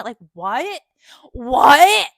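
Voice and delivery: loud incredulous what, rising; outraged what. Real, not acted. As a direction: shout the disbelief. Outraged at the remark.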